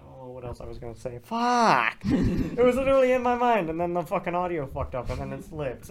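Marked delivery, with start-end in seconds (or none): 1.2-2.0 loud frustrated curse. Real, not acted. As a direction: swear at yourself in frustration. Forgot what to say.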